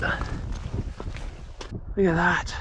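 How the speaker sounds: slow breathy whisper of awe; awed whisper. Real, not acted. Awed hushed remark.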